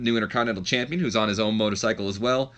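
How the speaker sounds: ordinary talk, nothing marked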